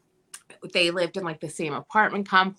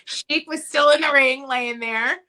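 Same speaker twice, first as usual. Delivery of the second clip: high, loud, laughing through the words; 9 semitones higher, 6 dB louder than usual. Real, not acted. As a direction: tell it through a burst of laughter. Laughing while telling the story.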